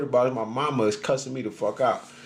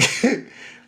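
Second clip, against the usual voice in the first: high breathy laugh; 12 semitones higher than usual. Real, not acted. Laughing at his own story.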